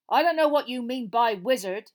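steadier defiance. A narrator's reading. Frank standing up to the cold voice, steadier and loud. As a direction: stand up to him loudly and firmly. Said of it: "his voice growing steadier".

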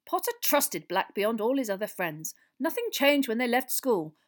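a hint of a pompous politician explaining; earnest Minister. A narrator's reading. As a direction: recount old history with emphasis.

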